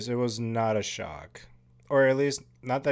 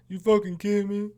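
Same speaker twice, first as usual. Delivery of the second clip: higher, louder disbelieving shout; mock outraged yell; 10 semitones higher, 5 dB louder than usual. Imitating Julian for viewers, mock outraged yell. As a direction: blurt it like the kid who was right all along.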